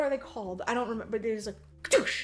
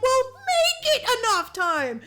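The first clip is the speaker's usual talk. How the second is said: mock retort; 12 semitones higher, 5 dB louder, slower than usual. Officials retort.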